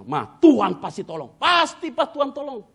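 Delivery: loud, high declaration of faith; emphatic assurance. Retold or imitated. Husband home from the pool reassuring his wife, loud emphatic assurance. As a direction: proclaim it loudly, stretching the last part.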